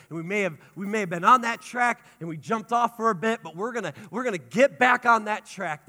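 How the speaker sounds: loud, driving sermon push; rising preaching. Acted. Pastor rallying the church back onto God's track, loud and fervent. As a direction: build the congregation up toward the finish.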